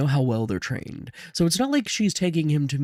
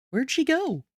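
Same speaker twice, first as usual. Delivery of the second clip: put-on very high little-kid voice; high-pitched child questioning a parent; child's question; 12 semitones higher than usual. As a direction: ask it high, like a small boy. Child asks father a question.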